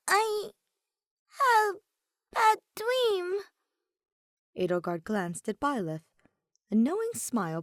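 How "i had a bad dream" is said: put-on high, hesitant child voice; a scared small girl, unsure; nervous little girl. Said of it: "Clancia hesitated, shifting nervously".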